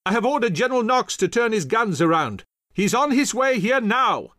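high, loud general's address; shouting over battle. A narrator's reading. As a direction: shout the news over cannon din.

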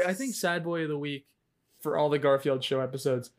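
ordinary talk

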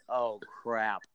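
low, soft resignation; quiet, resigned groan of words; eye-rolling dismay. Retold or imitated. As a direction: mutter it with a sinking realization. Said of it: "I just rolled my eyes like".

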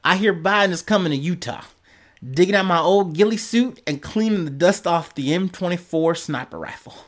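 a light mock Utah twang, reading the post; hog speak. Reading a Utah man's threatening post in hog speak. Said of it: "this is my hog speak".

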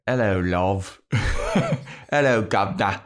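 lightly hammed-up Cockney greeting; mock Cockney Spike. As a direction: play Spike with a loose British accent. Voicing Spike with Cockney accent.

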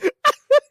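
very high helpless laugh; real shriek of laughter; squealing laugh. Real, not acted. Laughing hard.

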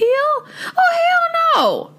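very high, shrieked refusal; outraged squeal. Retold or imitated. Telling her friend she's done with him, outraged squeal. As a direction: squeal it in reenacted disbelief. Retold outrage after call drops.

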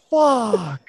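high, drawn-out frustrated curse; mock mad. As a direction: imitate a sore loser swearing. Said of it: "He's so mad. He's like".

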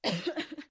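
sharp cough from choking. Real, not acted. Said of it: "I'm choking on something".

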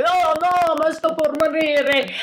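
high whiny Italian lament, slow; Fabio moaning. Retold or imitated. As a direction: wail it like a dramatic dying Italian.

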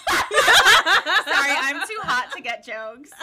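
helpless laughter breaking into the words; loud laughing tease, last words drawn out. Real, not acted. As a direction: crack up loudly while saying it. Laughing at co-host's joke.

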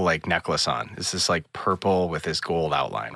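normal talking voice

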